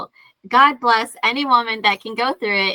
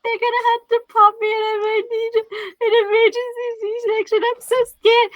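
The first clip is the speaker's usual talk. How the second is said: very high, frightened plea to mom; scared to mom; 12 semitones higher than usual. Retold or imitated. Retelling the hospital, phones her mom in a panic, very high and scared. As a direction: sound like a scared kid calling her mother. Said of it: "I'm like".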